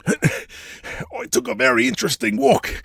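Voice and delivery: rough Australian boss boasting about his past; Australian tycoon bragging to his underling about hunting; brash Aussie hunter. Acted. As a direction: swagger through the outback tale, Australian. Jack addresses Nigel.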